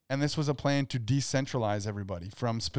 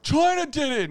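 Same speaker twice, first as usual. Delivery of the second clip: accusing voice; 13 semitones higher, 4 dB louder than usual. Retold or imitated. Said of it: "people pointing fingers like".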